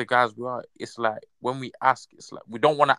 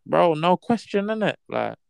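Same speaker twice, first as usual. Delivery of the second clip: laid-back mate; 6 semitones higher, 4 dB louder, slower than usual. Retold or imitated. Acting out his own reply.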